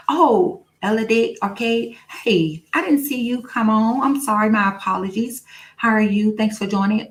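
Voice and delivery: high, apologetic hello; surprised greeting. Real, not acted. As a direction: greet a missed viewer up high.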